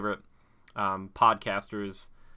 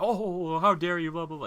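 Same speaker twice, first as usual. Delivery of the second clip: high-pitched mock-shocked reactor; exaggerated high mock outrage, tailing into babble; mocking reaction-video host; 8 semitones higher, 4 dB louder than usual. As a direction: parody an overreacting YouTuber in a raised voice. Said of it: "making weird noises and be like".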